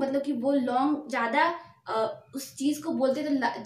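ordinary talk

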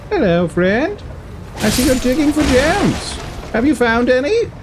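high, chummy put-on voice; playful, high-pitched small talk; mock friendly greeting. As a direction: greet an enemy in a silly high voice. Mock greeting to game enemy.

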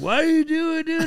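loud, very high protest; appalled friend. Retold or imitated. Friends see him squeeze pizza, high appalled yelp. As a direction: imitate a buddy's baffled yelp.

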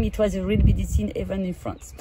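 usual speaking voice

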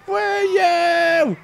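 very high, loud triumphant hollering; drawn-out loud party whoops; wild whoop. Real, not acted. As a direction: holler like showing off stunts. Showing off tricks, whooping.

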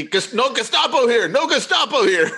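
high, repeated mock chant of outrage; insistent retold shout; imitating yelling pastor. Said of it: "he just kept yelling at them".

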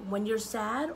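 ordinary delivery

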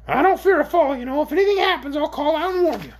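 very high, loud creature voice; shrill orc. A narrator's reading. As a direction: pipe up shrilly as a little creature. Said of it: "his shrill voice".

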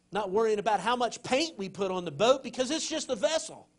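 loud, high, fervent preaching; preaching at full force. Acted. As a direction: rouse the congregation, voice lifted high and strong. Preacher building up excitement.

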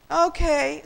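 high, loud yawned word; sleepy, stretched-out yawn. A narrator's reading. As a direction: let a yawn swallow the word. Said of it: "Sam yawned again".

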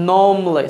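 normally as "NOM-lay", no R; silent R. Shown as a demonstration. Teacher illustrating the silent-R rule, "nom-lee". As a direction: leave the R out of normally, "nawm-lee". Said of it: "silent হলে কি হবে?".

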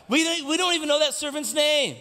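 high-pitched, insistent preaching; emphatic exclamation. Real, not acted. Preacher exclaims about the unknown servant, high and emphatic. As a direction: push it way up high to prove the point.

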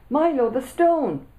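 slow, high, loud frightened plea; dramatic alarm. Acted. Friend on the phone, hammed-up scary cry. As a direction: stretch it out like a panicked warning. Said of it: "Such drama".

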